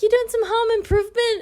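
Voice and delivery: high-pitched, put-on politeness; polite small talk. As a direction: chirp an awkward small-talk question up high. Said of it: "trying to make small talk to be polite".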